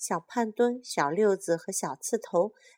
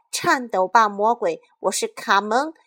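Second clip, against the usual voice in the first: shouting; 3 semitones higher, 6 dB louder than usual. Acted. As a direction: roar defiance at the monster. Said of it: "高喊道".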